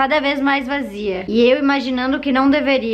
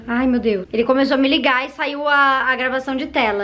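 ordinary delivery

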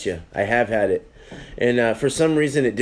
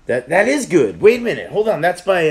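raised, louder burst with swooping pitch; excited recognition; 4 semitones higher, 5 dB louder than usual. Real, not acted. Reacting to a beer someone picked up, excited recognition. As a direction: catch yourself with sudden excitement.

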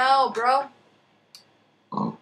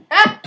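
startled scream; 11 semitones higher, 15 dB louder than usual. Real, not acted. Sudden fright while playing.

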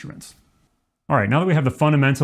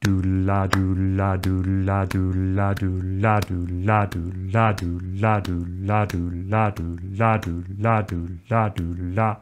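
chanted do la triplets, gradually leaning on la; even, then accented la; 3 semitones lower, slower than usual. Shown as a demonstration. Teacher adding swing accent over claps, steady chant. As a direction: start even and slowly build a subtle accent on la. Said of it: "start with it being even and then slowly add in this little bit of accent".

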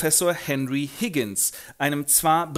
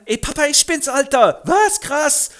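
sehr hoch, laut, prollig; Straßengöre; 13 semitones higher, 9 dB louder than usual. Acted. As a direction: patzige Göre im Jugendslang. Eliza zum Vater. (hohe, laute Prollstimme)

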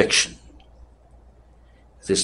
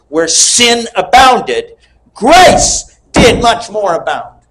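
high, slow, drawn-out pulpit quote; slow preaching proclamation; 10 semitones higher, 5 dB louder, slower than usual. Real, not acted. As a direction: proclaim it slowly to the congregation. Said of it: "I'll say it again".

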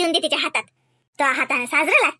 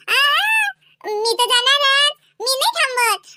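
stubborn refusal; 8 semitones higher, slower than usual. Real, not acted. Little girl firmly refusing to stay with grandmother. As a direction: dig in and refuse.

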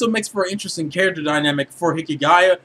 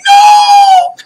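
very high, loud, drawn-out wail; hammed-up shriek of horror at the screen; mock anguished scream; 12 dB louder than usual. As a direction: overdramatic high-pitched fan scream. Acting out viewer's horrified reaction.

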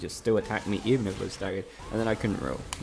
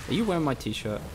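usual speaking voice

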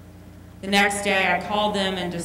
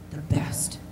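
hushed whispered tag; 4 semitones lower, 5 dB quieter than usual. Acted. Telling the church what God does best, whispered. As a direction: let it fall to a whisper. Sermon punchline dropped to whisper.

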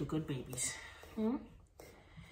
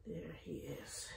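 hushed whisper; 3 semitones lower, 12 dB quieter than usual. Real, not acted. Soothing puppy while stacking.

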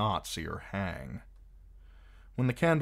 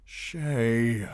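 groaned; 5 semitones higher, 6 dB louder than usual. A narrator's reading. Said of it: "he groaned".

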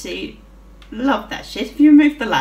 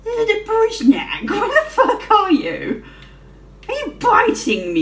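laughing surprise; 11 semitones higher, 4 dB louder than usual. Real, not acted. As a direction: squeal it through laughter at the surprise.

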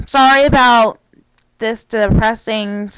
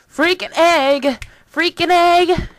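playful; 6 semitones higher, slower than usual. Shown as a demonstration. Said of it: "phrase repeated higher, playful".

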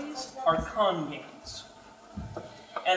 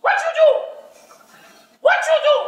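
much higher and louder, shrill nagging wife voice; goblin wife; 11 dB louder, much faster than usual. Acted. Goblin wife catches husband giving away goods, shrieking. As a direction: screech at your husband. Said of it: "my wife comes out".

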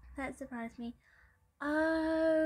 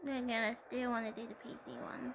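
normal talking voice

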